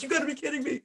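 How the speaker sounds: high-pitched, incredulous outburst; thrilled disbelief. Retold or imitated. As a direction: squeal it in disbelief, reliving the big news. Reacting to big-league call-up.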